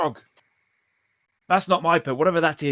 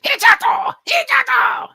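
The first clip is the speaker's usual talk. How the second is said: very high, shouted shriek; piercing fiendish cries, loud and high; Abyssal creature; 8 dB louder, slower than usual. Acted. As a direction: screech back in a demon tongue. Game master voices Abyssal creature replying.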